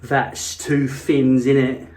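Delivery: slow, punched Cockney imitation; Cockney accent. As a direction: hit it like a Cockney, slowly. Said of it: "that kind of Cockney accent".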